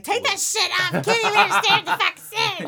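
exasperated mock-mom rant; mom's outburst. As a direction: rail like a fed-up mom on the phone. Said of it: "My mom always be like".

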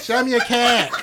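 mock seductive. Asking if the guys would be aroused, he hams a slow come-on. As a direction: drag it out like a cheesy come-on. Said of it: "would you be aroused".

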